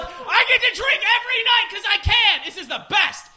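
delighted inner voice. Retold or imitated. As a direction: cheer it like the best news ever. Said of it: "and I should be like".

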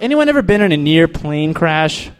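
ordinary delivery

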